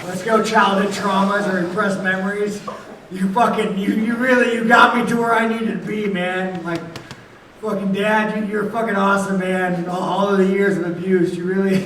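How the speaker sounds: loud mock-grateful yell at his past; sarcastic shouting. Real, not acted. Fighter mockingly thanks his father, loud sarcasm. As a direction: shout thanks to the abuse with bitter irony.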